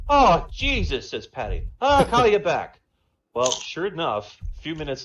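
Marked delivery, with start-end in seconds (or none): 0.1-1.1 high, loud Irish yelp of alarm; a startled Irishman backing off fast; panicked Irish accent. Acted. Paddy hearing the army size, alarmed Irish accent. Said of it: "Irish and Jamaican". 1.8-2.8 high, loud Irish yelp of alarm; a startled Irishman backing off fast; panicked Irish accent. Acted. Paddy hearing the army size, alarmed Irish accent. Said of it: "Irish and Jamaican".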